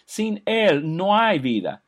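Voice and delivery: emphatic preaching. Acted.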